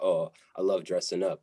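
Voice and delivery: ordinary delivery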